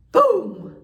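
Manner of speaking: sudden loud imitated crash; loud boom. Telling how her van fell, loud boom.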